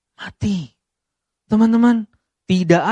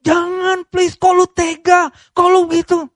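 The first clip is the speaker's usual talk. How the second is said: mock a betrayed wife begging loudly; hysterical pleading; 11 semitones higher, 3 dB louder than usual. Wife pleads while hitting husband.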